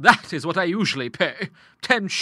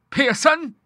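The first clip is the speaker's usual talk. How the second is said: startled question; 12 semitones higher than usual. A narrator's reading. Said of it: "said she".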